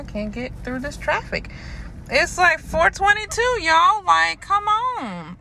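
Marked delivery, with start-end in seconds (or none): 2.1-5.4 exasperated. Real, not acted. Stuck in rush-hour traffic.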